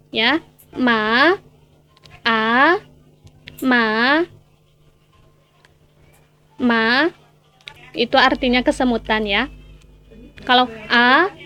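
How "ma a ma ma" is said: "ma" sliding upward to the end; rising second tone. Shown as a demonstration. Teacher demonstrates the second tone on "ma", rising steadily. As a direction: lift "ma" like a surprised question. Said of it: "nada kedua ada nada naik".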